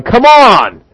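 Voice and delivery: loud, high call-out as James; exasperated urging. Acted. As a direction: burst out at slow listeners. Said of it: "James is standing here going".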